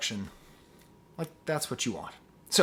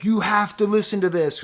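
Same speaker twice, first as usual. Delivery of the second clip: loud, high, hammed-up urging; mock-urgent raised voice; mock thumbnail hype; 8 semitones higher, 8 dB louder than usual. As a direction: oversell it like a clickbait headline. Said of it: "make a thumbnail with me saying".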